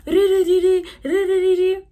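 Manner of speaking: high, loud rolling re-re-re; imitated whirring noise. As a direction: rattle off a loud high sound effect. Mimics the eye test process.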